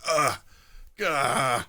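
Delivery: acted groans of revulsion; disgusted retching. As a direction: gag at an awful smell. Acting out disgust at the smell.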